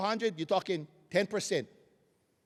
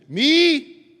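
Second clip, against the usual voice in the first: call and response; 10 dB louder, much slower than usual. Shown as a demonstration. Speaker leads the hall in a call-and-response chant. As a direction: chant it back and forth with the room. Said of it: "So repeat after me".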